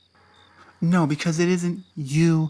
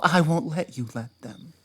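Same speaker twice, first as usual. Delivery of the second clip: ordinary talk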